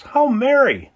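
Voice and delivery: plain reading voice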